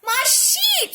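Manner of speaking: a horrified farmer's shriek; scream. A narrator's reading. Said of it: "screamed Farmer Fifi".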